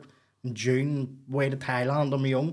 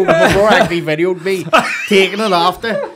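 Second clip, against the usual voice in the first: usual speaking voice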